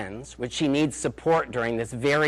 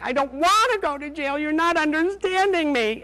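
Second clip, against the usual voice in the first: much higher, wailing mock sob; retold crying mother, higher and whiny; imitated crying; 14 semitones higher, 3 dB louder than usual. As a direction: play the sobbing mother begging, pitched way up. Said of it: "she kept crying, saying".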